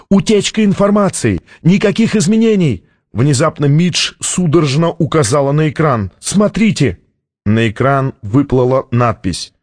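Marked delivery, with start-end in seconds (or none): 0.1-2.8 high, urgent warning cry; acted shout, volume held; alarmed shout. A narrator's reading. Staff shouting status during alarm. 6.2-7.0 high, sudden call; mildly excited cry; urgent exclamation. A narrator's reading. Suddenly pointing at the screen.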